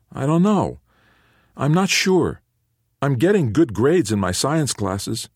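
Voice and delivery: a son daring to dream aloud; hopeful teen. A narrator's reading. Teen son explains his dream.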